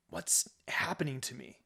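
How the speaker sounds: unvoiced whisper of confusion; whisper to himself. A narrator's reading. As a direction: breathe it out to yourself. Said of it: "he asks himself".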